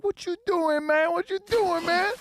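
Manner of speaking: very high-pitched indignant protest; imitating loud outrage. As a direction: squawk it like the guy you just splashed. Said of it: "he got all loud".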